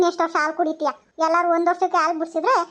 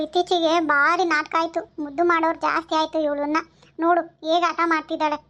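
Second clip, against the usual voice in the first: normal talking voice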